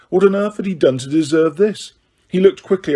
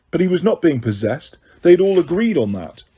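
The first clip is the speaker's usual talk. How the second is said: usual speaking voice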